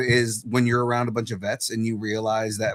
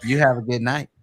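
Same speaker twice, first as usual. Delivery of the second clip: light, higher, slightly fast chummy voice; a hint of a gentle, chatty Bruce; Bruce from Family Guy; 6 semitones higher, 4 dB louder, faster than usual. Veteran voices the bug chatting as it leaves, like Bruce. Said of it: "Why do you sound like Bruce from Family Guy".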